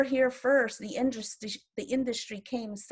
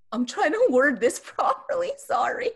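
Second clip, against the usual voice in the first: a shaky laugh breaking into tears, a bit louder and higher; laughing through tears; 7 semitones higher, 6 dB louder than usual. Real, not acted. Elder to interviewer, laughing through real tears. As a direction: apologise while a real laugh turns to crying. Overcome while speaking on dialogue.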